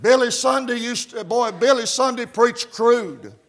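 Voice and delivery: high, loud revival preaching with gasped "uh" between phrases; fiery country Baptist sermon cadence; full-force preaching. Real, not acted. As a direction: preach it hard, driving every phrase up high.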